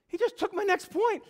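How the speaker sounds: high, loud dismay; acted panic. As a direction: blurt it out in mock panic. Young preacher panics at stolen point.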